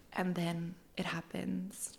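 suspenseful whisper before the proposal reveal; dramatic whisper. Acted.

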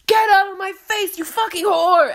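high, loud imitated screaming at a rival; hammed-up furious shriek; mock screaming rage. A reality star screaming at a coworker, mock high loud rage.

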